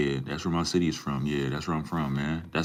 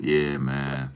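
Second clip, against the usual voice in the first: usual speaking voice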